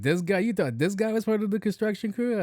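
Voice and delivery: high-pitched incredulous group reaction; mock disbelief. Coworkers question the lady's mistake, high mock disbelief.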